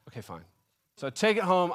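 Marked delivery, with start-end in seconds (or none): 0.1-0.4 very soft and low after a sigh; sigh, then give in under your breath; grudging surrender. Retold or imitated. Customer giving in to the counter girl, mock-resigned.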